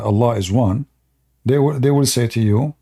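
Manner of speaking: usual speaking voice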